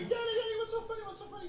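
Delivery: put-on very high squeaky child voices; excited little kids. Kids running in behind laughing dad, high-pitched eager questioning. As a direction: mimic small kids pestering in falsetto.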